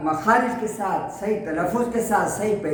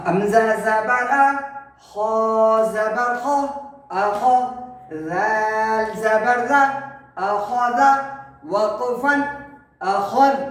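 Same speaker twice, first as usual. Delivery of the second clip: measured chant, letter names drawn out; spelling drill recitation; slower than usual. Shown as a demonstration. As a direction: spell it out syllable by syllable, then join.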